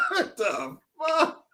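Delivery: high slow laughing curse; laughing disbelief. Real, not acted. Listener laughing at the clip, high and slow. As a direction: crack up asking it.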